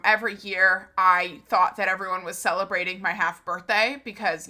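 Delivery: normal talking voice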